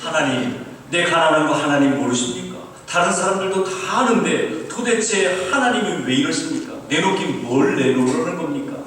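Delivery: imitated loud, indignant complaint; heated protest. Poor student challenging God's demand, acted angry protest. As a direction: demand an answer from God, mock outrage. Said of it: "확 열이 났어요".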